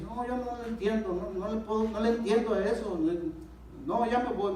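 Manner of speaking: retelling a feeble excuse to his wife; acted reluctant refusal.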